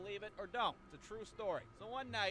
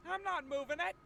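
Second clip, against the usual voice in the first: higher, louder whiny protest; Cody insists he's innocent; lightly voiced drunk friend; 11 semitones higher, 7 dB louder than usual.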